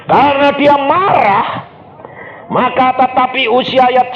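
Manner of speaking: loud, high declamatory scripture reading; forceful shouted sermon delivery; full-force preaching. Acted. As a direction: thunder the verse to the congregation.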